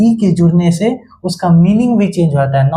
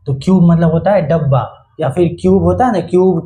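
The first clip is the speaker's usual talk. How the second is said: usual speaking voice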